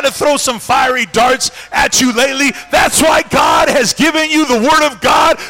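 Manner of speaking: high, loud sermon shout; full-force preaching. Real, not acted. Preacher tells church God gave them faith, shouted preaching. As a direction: proclaim it forcefully to the room.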